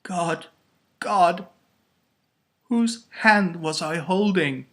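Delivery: high, loud, halting dread; horrified realization. A narrator's reading. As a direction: let the horror dawn between pauses. Eleanor shuddering, horrified at realization.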